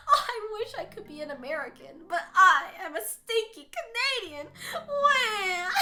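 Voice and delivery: hammed-up whiny sob ending in a wail; fake-blubber about being Canadian; mock crying. Teasing Pepperoni about crying at Cleveland's ballpark, mock sobbing. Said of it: "you're tearing up 'cause you're like".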